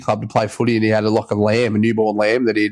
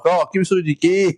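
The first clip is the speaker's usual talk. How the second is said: imitated Heineken guy; 7 semitones higher than usual. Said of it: "the Heineken guy's like".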